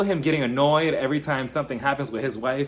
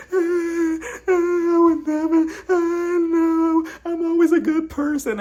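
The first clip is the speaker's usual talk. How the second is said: hammed-up high, louder sobbing protest; mock crying wail; 5 dB louder, slower than usual. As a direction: whine it like fake tears pouring out. Imitating Ayan crying, protesting innocence.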